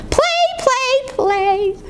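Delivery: put-on high eager baby cry; excited baby goose. Gosling to Jack, high excited cry. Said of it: "cried the excited baby goose".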